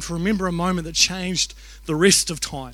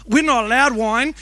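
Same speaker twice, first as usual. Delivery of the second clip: louder, higher imitated child's objection; protesting kids; 5 semitones higher, 7 dB louder than usual. Kids interrupting the communion lesson, lightly mimicked protest. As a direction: object like a rule-minded kid, lightly voiced.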